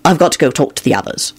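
ordinary delivery

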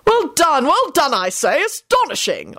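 high, hearty congratulations; enthused. A narrator's reading. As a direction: cheer it high, settling by the end. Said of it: "Arthur enthused".

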